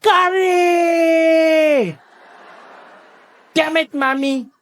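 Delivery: high, drawn-out outraged scream; screaming Thiessen. Retold or imitated. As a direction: wail it at the top of your lungs. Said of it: "he'd scream at the top of his lungs".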